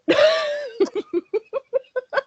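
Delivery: high teary laugh, near sobbing; helpless laugh close to tears; crying laughter. Real, not acted. Cohost breaks down laughing at OnlyFans story, teary laugh.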